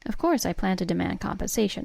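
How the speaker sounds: ordinary delivery, nothing marked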